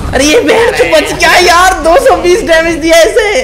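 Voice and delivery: high, loud disbelief; incredulous outcry. Real, not acted. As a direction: squeal it in disbelief. Laughing outrage enemy survived. (हैरानी में ऊँची चीख)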